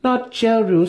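high, firm correction; Santa Fe's voice. A narrator's reading. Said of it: "Santa Fe replied".

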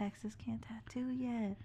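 whispering. Real, not acted. Conspiratorial joke.

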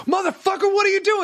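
high-pitched outraged cyclist yell; imitated angry yell. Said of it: "one fucking yelled at me".

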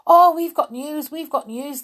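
high, eager cry that starts loud; excited cry. A narrator's reading. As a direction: call out eagerly with news. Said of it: "they cried".

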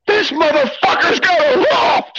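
mock shocked scream at the loft; retold scream. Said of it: "and he screams".

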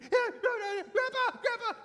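put-on high, loud cries of a panicked small child; freaking out. Little brother calling Grandpa from the rolling car, freaking out. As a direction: imitate a little boy yelling for help. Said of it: "my brother in the back seat's freaking out".

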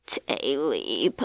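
low, soft, eerie calling from the dark; a low unearthly voice from the woods; strange creature voice. A narrator's reading. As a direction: call out low and uncanny. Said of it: "he heard a strange voice".

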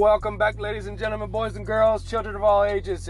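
booming showman's announcement; ringmaster. Acted. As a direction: step into the ring and welcome the crowd. Circus-style podcast intro.

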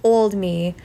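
usual speaking voice

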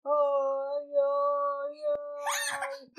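high, wailing mock cry of ay ay ay; storybook ant whimpering as she cries. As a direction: wail it like a lost little ant. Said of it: "la hormiga ... lloraba".

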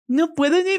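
high, silly mock whine; ridiculing idiot voice. Shown as a demonstration. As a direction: squeak it out like a fool mocking himself. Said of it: "los empezamos a ridiculizar".

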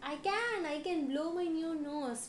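high, loud trumpeting storybook voice; trumpeting elephant. A narrator's reading. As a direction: trumpet it out high, opening on a long blast. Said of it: "trumpeted elephant".

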